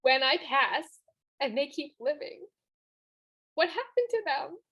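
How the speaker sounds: holding back tears while speaking; real emotion welling up; tearing up. Real, not acted. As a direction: voice lifting as tears come. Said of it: "Sorry, I'm getting emotional".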